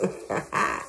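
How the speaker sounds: brief, soft chuckle; quick amused laugh; short laugh. Real, not acted. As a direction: laugh lightly at yourself. Amused at spoiling her grandkids.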